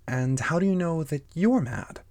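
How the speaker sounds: high-pitched challenging question; questioning Alice. Acted. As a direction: question it as a puzzled storybook girl. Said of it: "she went on".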